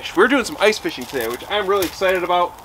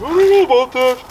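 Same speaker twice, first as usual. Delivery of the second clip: voicing the dog; 10 semitones higher than usual. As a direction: voice the dog's doubt, a little higher. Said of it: "He's like".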